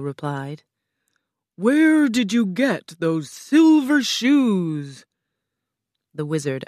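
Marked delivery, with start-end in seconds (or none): 1.6-5.0 loud, high, slow questioning; booming Oz. A narrator's reading. The wizard asks about silver shoes, booming and slow. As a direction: boom the question out grandly.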